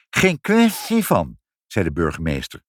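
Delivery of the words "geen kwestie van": high, loud, slow, slurred "s" in "kwestie"; lisping refusal. Acted. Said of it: "Ik kan de F niet zeggen".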